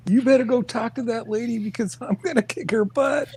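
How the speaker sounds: high-pitched angry outburst, near tears; furious coworker yelling. Retold or imitated. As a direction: storm in and yell that you'll kick her butt. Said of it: "She was pissed".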